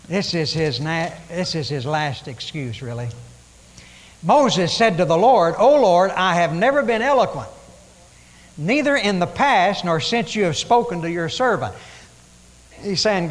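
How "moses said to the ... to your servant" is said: loud, ringing scripture reading; preaching scripture. Acted. Preacher reading Moses' words aloud, loud and ringing. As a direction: proclaim the verse to the congregation. Reads scripture at full force.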